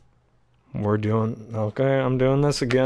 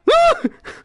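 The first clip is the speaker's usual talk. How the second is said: loud laugh; 5 dB louder than usual. Real, not acted.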